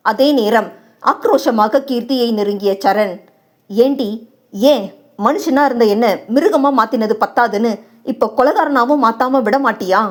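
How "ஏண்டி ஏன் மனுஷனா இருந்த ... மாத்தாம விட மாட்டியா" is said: ordinary delivery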